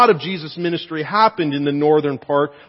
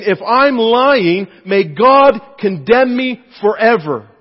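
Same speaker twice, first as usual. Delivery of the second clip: loud, high, slow oath; emphatic self-curse; 6 semitones higher, 8 dB louder, slower than usual. Retold or imitated. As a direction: call the curse down on yourself. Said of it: "that is about as emphatic as you can possibly get".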